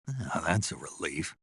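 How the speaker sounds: softly spoken dry sarcasm; sarcastic. Acted. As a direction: feign relief with quiet sarcasm. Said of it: "I was being sarcastic".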